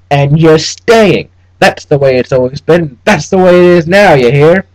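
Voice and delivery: put-on high, loud, twangy old-West bluster; a petty clerk laying down the rules of hell; blustering frontier clerk. Trubshaw lecturing the newcomer.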